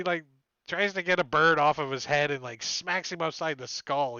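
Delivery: ordinary talk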